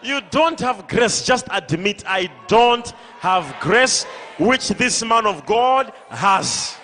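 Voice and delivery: loud, forceful pulpit rebuke; full-force preaching. Real, not acted. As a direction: preach it hard, pleading with the stubborn.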